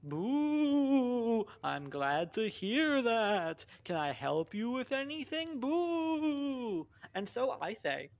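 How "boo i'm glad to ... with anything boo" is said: lightly voiced ghost, higher and slow; a polite spooky ghost. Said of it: "And he says".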